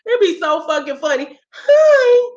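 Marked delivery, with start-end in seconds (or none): 1.5-2.3 put-on squeaky baby-talk hi, much higher and louder; mock the fake sweet voice adults use with kids; high sing-song kid voice. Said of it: "that voice people change into when they talking to kids".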